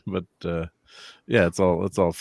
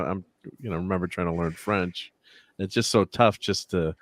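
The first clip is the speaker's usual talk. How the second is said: normal talking voice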